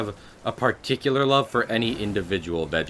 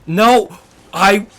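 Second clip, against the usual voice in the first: loud, high frustrated yell; raging gamer; 10 semitones higher, 9 dB louder than usual. Real, not acted. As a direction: shout no at the screen. Gets hit by boss.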